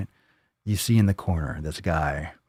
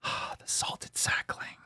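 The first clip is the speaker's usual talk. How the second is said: slow breathy whisper of the title; 12 dB quieter, much slower than usual. Shown as a demonstration. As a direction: hush it like a secret. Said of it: "repeats title differently".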